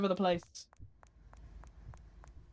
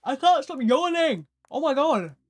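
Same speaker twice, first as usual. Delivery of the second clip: high, loud exasperated outburst; exasperated crash out; 6 semitones higher, 7 dB louder than usual. Real, not acted. Streamer who can't stop yawning, crashing out. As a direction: cry out, fed up with yourself. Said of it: "Top five crash outs".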